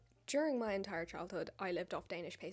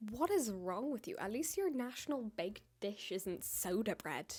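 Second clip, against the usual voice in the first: ordinary delivery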